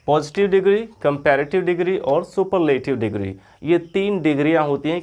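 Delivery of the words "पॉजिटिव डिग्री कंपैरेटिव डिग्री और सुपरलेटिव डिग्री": ordinary talk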